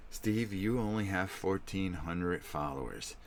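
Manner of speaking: slow, drawn-out menacing taunt; imitating his own creepy villain act; vicious creepy voice. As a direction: gloat slowly, spacing out each word. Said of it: "I go into this vicious thing".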